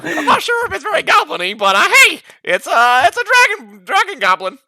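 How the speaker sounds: light goblin voice; lightly voiced goblin. Shown as a demonstration. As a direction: keep a light goblin voice going. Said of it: "this is my goblin voice".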